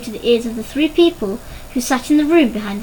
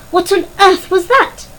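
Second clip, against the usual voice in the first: high, louder startled question; alarmed cry; 7 semitones higher, 5 dB louder than usual. A narrator's reading. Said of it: "cried Missus Parker".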